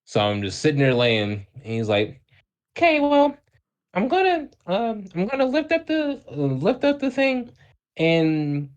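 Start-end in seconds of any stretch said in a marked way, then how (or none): 2.8-8.7 halting falsetto doctor voice, with ums; mock falsetto, hesitating through instructions; high-pitched doctor. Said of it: "I can't sit here and do it in his voice".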